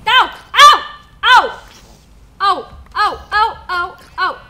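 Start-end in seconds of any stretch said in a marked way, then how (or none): 0.1-1.6 mock pain yelps. Being hit in game.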